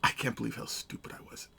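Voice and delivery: embarrassed whisper. Real, not acted. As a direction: whisper it to yourself, sheepish. Muttering after hurting himself.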